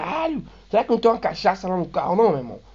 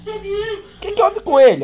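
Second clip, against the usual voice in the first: lightly voiced woman, much higher and slow; nurse's voice; 5 dB louder, slower than usual. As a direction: put on a higher female voice. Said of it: "A mulher perguntou pro Dudu".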